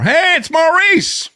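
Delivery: high, slow mock greeting of a cheerful phone clerk; imitating Maurice.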